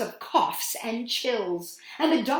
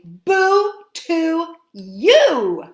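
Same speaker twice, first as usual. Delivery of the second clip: slow, loud, higher "Boo to you"; bold boo; 7 semitones higher, 11 dB louder, much slower than usual. Shown as a demonstration. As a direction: stare it down and boom each word. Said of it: "look it in the eye and say".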